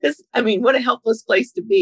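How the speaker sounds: normal talking voice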